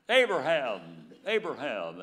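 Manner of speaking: loud, drawn-out call of a name; preacher voicing God's loud summons. God calling Abraham by name twice.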